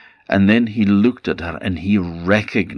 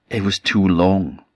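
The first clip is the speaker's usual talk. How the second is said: ordinary delivery, nothing marked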